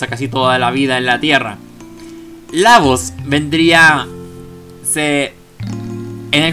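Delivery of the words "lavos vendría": ordinary talk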